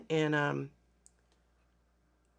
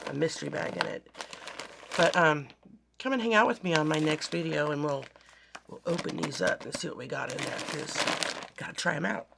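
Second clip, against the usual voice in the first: usual speaking voice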